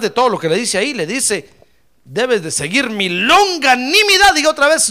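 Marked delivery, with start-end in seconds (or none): 2.0-4.3 slow build to a loud, high longanimidad; proclaimed scripture. Acted. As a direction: raise and stretch the final word longanimidad. Proclaims scripture's key word. (proclamando con énfasis creciente)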